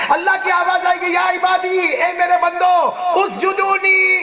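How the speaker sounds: high, drawn-out proclamation; divine summons. Acted. Allah summoning his servants on Judgement Day, proclaiming. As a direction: call out with a long held ending. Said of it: "اللہ کی آواز کیسی ہوگی".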